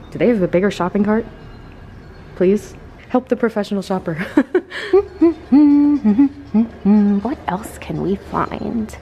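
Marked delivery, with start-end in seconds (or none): 4.2-7.2 laugh. Real, not acted. Laughing at herself shopping.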